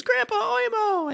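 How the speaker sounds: put-on very high, slow child's cry; drawn-out high child's wail. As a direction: call out to grandpa like a frightened kid. Child cries out to grandfather.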